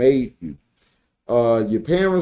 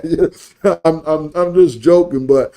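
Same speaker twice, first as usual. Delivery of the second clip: ordinary talk, nothing marked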